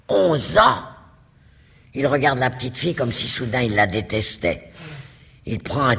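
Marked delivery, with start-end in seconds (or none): 0.1-0.8 violent anger. Acted. The duke suddenly turns on the girl, furious outburst. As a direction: burst out in fury at her age. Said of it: "saisi tout à coup d'une violente colère".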